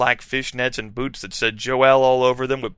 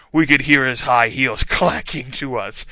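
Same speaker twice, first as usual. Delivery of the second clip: ordinary delivery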